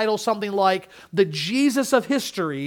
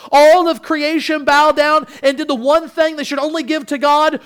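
builds higher and louder to a full-voiced peak; rising preaching climax; 5 semitones higher, 6 dB louder than usual. Preaching climax on Christ's rule.

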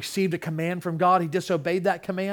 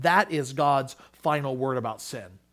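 ordinary delivery

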